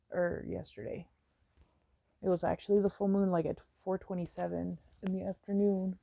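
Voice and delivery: usual speaking voice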